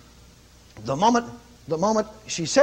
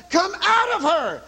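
commanding rebuke; 11 semitones higher, slower than usual. Retold or imitated. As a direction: drag out the last order to the spirit. Retold casting out a spirit.

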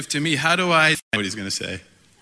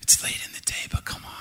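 unvoiced, very soft and hushed; whispering a cherished line; whispered phrase; 6 dB quieter, faster than usual. Shown as a demonstration. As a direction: breathe the phrase like a secret. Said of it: "That beautiful phrase".

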